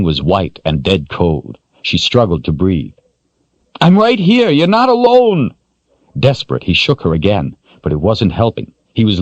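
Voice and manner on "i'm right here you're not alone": urgent reassuring man. Acted. Richard voiced, urgent.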